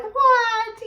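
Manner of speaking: high, loud disbelieving what; mock disbelief at husband's claim; incredulous what. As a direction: shoot it up in shock.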